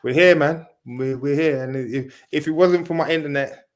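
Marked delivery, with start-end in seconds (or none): none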